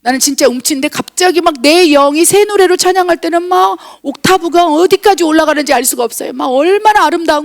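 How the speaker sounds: ordinary talk, nothing marked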